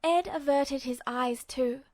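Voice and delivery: ordinary delivery, nothing marked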